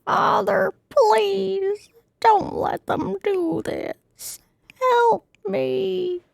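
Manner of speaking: high squeaky puppet voice protesting; Pinocchio. Acted. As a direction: pitch way up like a wooden boy. Pinocchio begs his father.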